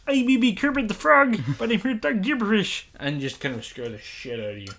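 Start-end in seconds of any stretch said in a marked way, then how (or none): 0.1-2.9 loud, very high puppet voice; Kermit the Frog. Acted. Co-host doing the intro to scare his friend, loud Kermit voice. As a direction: belt it out like a frog puppet presenter. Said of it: "I want to do the kind of Kermit voice".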